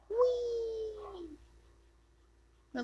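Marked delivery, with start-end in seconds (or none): 0.1-1.4 very high sing-song whee; playful whee. Real, not acted. Player zooming around the map, gleeful. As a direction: glide up into a happy whee. Skating fast in game.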